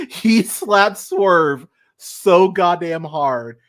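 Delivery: slowed, amazed emphasis; excited. Real, not acted. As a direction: lean on every word in disbelief. Raving about a huge slap.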